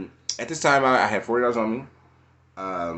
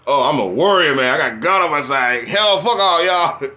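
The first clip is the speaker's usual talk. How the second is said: louder, cocky, fired-up boast; defiant bravado; 4 semitones higher, 6 dB louder than usual. Retold or imitated. Retelling his defiant attitude toward family and doctor, swaggering. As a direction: puff up like an unbeatable warrior. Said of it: "So that was my attitude".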